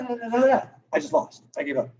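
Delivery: usual speaking voice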